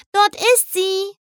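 high, drawn-out little foal voice; high, delicate child-pony squeal. A narrator's reading. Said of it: "quietschte Sweetie Belle mit ihrer zarten Fohlenstimme".

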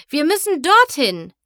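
loud urgent call; shouting. A narrator's reading. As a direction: call out to the group. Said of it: "schrie Twilight Sparkle".

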